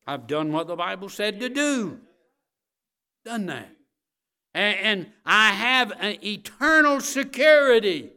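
loud, high-pitched preacher's declaration; forceful pulpit proclamation; full-force preaching. Real, not acted.

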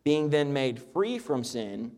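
measured King James reading voice; preacher reading the Bible aloud to the congregation; scripture reading. Acted. Pastor reads Romans to his church, steady and measured.

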